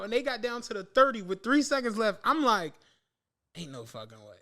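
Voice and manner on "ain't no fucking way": hushed disbelief. Retold or imitated. Chiefs drive in final seconds.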